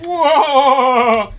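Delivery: loud, high, stretched Cockney howl; Cockney accent. Shown as a demonstration. Streamer demonstrating East London speech, loud Cockney whoop. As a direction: bellow it like a caricature Cockney. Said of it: "first bit of any Cockney accent".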